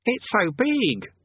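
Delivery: put-on high, slow boyish wonder; awed boy. A narrator's reading. Boy Eric seeing the world, high and awed. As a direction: draw it out in high-pitched awe. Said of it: "breathed Eric".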